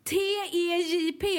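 high, slow "T E J P", letter by letter; exasperated spelling out. Retold or imitated. Care worker spells word for old lady, exasperated and slow. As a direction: spell "T E J P" slowly on a high pitch.

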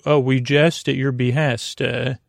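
normal talking voice

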